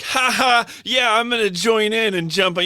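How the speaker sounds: high, loud gleeful mock laugh; mocking goofy voice. Retelling the other guy's reaction, high gleeful taunting. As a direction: play the smug guy joining in. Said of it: "he's just like".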